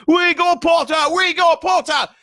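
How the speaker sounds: high, loud chanting cheer; twins yelling. Acted. Weasley twins yelling their cheer for Harry. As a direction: cheer it across the hall. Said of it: "the Weasley twins yelled".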